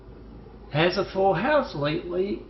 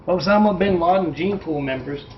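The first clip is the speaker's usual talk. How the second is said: normal talking voice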